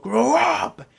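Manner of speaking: high, loud imitated bellow; mock furious roar; retold yell. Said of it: "and then he just yelled".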